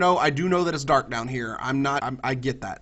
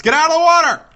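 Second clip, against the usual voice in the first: high, loud yell at his pet; 11 semitones higher, 12 dB louder than usual. Real, not acted. Gamer yells at his pet Lance, high and loud. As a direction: shout the warning out.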